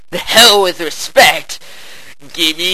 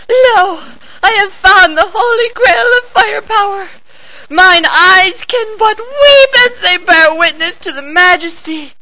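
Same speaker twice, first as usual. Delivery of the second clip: hammed-up holy ecstasy; mock reverent awe; 12 semitones higher than usual. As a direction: proclaim like a prophet beholding a miracle. Reverent awe at big gun.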